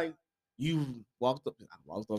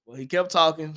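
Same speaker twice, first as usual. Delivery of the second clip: usual speaking voice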